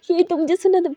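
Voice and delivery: usual speaking voice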